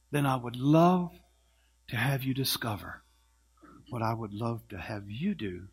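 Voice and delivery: from plain down to a soft whisper; fading to whisper. Acted. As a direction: let the offer sink to a hush. Maestro's offer softening.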